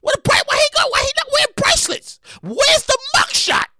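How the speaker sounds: high, loud disbelieving rant; incredulous outburst. Real, not acted. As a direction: demand answers in shrill disbelief. Outraged ranting about missing perp walk.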